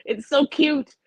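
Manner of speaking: high, squealing mock gush; gushing over cuteness. Retelling her reaction to the Canadians' speech, high adoring gush. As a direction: coo and squeal as if overwhelmed by cuteness. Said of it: "I would turn around and be like".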